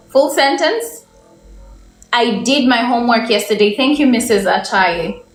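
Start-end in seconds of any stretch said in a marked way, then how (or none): none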